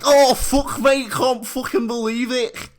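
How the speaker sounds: high, loud mock amazement; imitated amazed shouting. Imagining friend receiving the trade, amazed shout. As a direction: cry out like an astonished mate.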